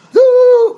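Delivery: loud high shrill horn note; a blast to shake you awake; wake-up shofar blast. Shown as a demonstration.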